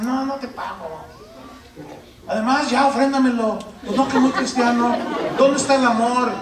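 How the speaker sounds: high, loud, self-righteous refusal; mock indignant debtor. Pastor acting a cheeky debtor refusing to pay, high and loud. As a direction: play the smug debtor.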